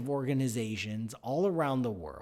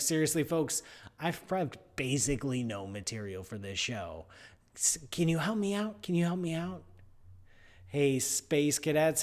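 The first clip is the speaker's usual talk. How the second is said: normal talking voice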